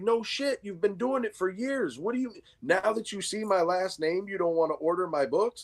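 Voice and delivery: high-pitched, indignant comeback; exasperated protest. Retold or imitated. Retold argument with store owner.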